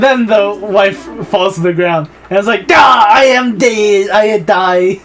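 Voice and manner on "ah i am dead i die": loud, slow, hammed-up dying wail; mock death cry. Poisoned wife collapsing, theatrical death cry. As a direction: cry out, then let it sink. Acts wife falling dead.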